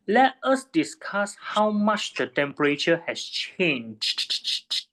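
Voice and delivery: slow, deliberate build up to a heavily stressed "changed". Real, not acted. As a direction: hammer the word "changed" at the end, slowly. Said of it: "I stress the word change a lot".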